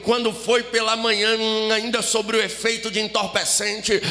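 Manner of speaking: rising, driving testimony over music; impassioned preaching. Real, not acted. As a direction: preach it with mounting fervour.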